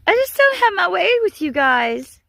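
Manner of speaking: ordinary talk, nothing marked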